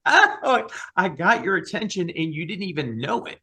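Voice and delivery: high, loud, playful voice of God; God laughing. Retold or imitated. God gleefully teasing the singer who didn't notice, high and loud. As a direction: crow it out like God enjoying the joke. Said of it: "I hear God laughing".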